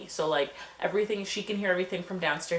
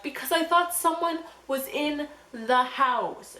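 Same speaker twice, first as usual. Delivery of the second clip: crying; 7 semitones higher than usual. Retold or imitated. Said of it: "I'm over here crying".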